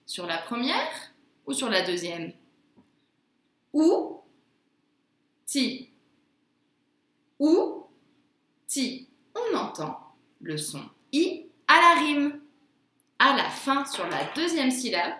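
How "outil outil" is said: "Outil" split into ou, long pause, ti; syllable by syllable. Shown as a demonstration. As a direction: say "Outil" as two separate syllables, twice. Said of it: "Sur ces deux syllabes".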